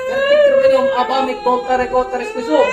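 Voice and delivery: high, loud chanted Latin formulas with shouted Po; exorcism incantation. Real, not acted. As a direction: cast the spirit out at full force.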